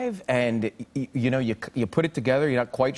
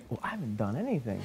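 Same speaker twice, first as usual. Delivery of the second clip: much softer, as if to himself; retold sheepish thought; 3 semitones lower, 10 dB quieter than usual. Guest recalls thinking at Larry King's dinner, quiet aside. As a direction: drop the volume for a small private admission.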